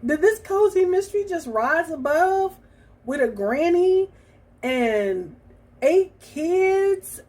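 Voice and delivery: high, loud astonished quote; amazed inner thought. Retold or imitated. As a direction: gush it like a stunned discovery. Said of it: "And I was just like".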